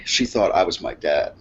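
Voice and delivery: ordinary talk, nothing marked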